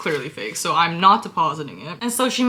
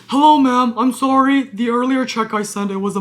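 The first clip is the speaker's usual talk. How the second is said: higher, much louder fake-polite greeting; mock polite email; 5 semitones higher, 7 dB louder than usual. Reading the scammer's email, a loud fake-polite greeting. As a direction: ham up the scammer's sweet apology.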